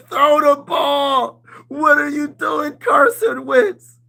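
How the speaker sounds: high, loud, slow yell at the TV; screaming at the QB. Retold or imitated. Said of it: "I be screaming that every game".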